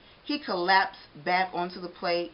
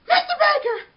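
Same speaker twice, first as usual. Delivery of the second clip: ordinary delivery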